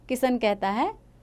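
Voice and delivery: ordinary delivery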